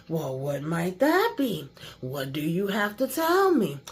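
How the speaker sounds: father voiced low and stern; mock stern father. Father's voice again.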